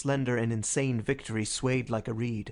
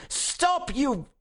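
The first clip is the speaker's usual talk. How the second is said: high-pitched yell, cut off; frantic cry; 14 semitones higher than usual. A narrator's reading. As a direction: desperately try to stop the crowd. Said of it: "at the top of a voice that tore his throat".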